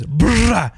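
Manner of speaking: "Brrrah" with a long buzzing trilled R, then a short ah; rolled R attempt. Shown as a demonstration. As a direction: trill the R in "Brrrah" hard and loud. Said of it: "I've been practicing the rolling of my R's".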